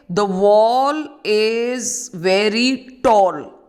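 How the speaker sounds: "The wall is very tall" said very slowly, each word drawn out; slow example sentence. Shown as a demonstration. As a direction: spell out the example slowly for students. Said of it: "if I say".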